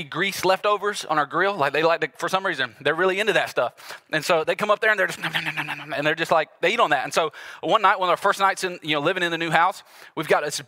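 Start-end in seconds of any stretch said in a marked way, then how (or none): 5.2-5.9 raccoons munching away, rapid nom-noms; imitated munching. Raccoons eating grill leftovers, imitated greedy munching. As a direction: gobble it up in quick repeated noms.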